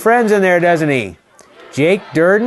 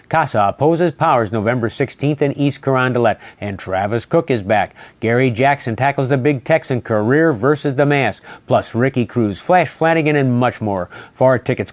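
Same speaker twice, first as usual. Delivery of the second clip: lower, measured promo announcer voice; ad read; 5 semitones lower than usual. Acted. Promoting Saturday's title match to viewers, ad read. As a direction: sell the card like a TV spot. Reads event promo with phone number.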